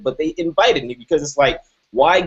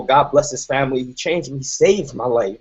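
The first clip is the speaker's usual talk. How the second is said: usual speaking voice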